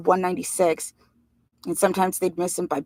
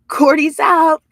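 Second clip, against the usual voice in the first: loud, much higher triumphant yell; shouted call; 10 semitones higher, 11 dB louder, slower than usual. A narrator's reading. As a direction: bark it out like a kid who just won. Teddy calling out the winning hand.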